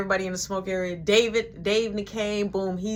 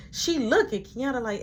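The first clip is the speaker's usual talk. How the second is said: normal talking voice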